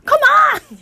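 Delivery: desperate toilet-queue come on; impatient yell. Acted. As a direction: blurt it outside the toilet door. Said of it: "앞에 먼저 들어간 사람이 너무 안 나와".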